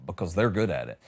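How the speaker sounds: ordinary delivery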